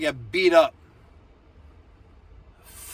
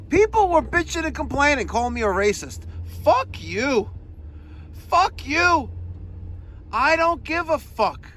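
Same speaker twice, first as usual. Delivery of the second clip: much higher, heated defiance; defiant rant; 11 semitones higher than usual. Real, not acted. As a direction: fire back at the critics. Ranting at critics.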